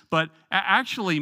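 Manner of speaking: ordinary talk, nothing marked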